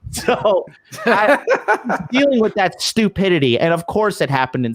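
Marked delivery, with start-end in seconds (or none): 0.0-3.6 talking through laughter, pitched up; real laughing. Real, not acted. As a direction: chuckle through the words in disbelief. Laughing at his own story.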